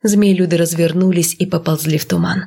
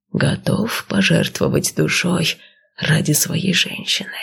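serpent seer voice. Acted. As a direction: breathe it out like an old serpent. Snake warns the husband.

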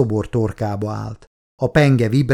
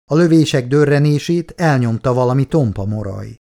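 plain reading voice

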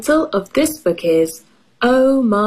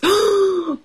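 delighted gasp; 11 semitones higher than usual. Acted. Excited gasp at muffins.

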